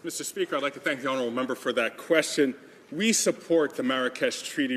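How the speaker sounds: normal talking voice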